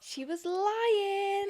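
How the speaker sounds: high, slow teasing voice. Real, not acted. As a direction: tease in a sing-song. Teasing mock accusation.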